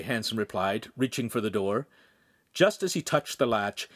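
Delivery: usual speaking voice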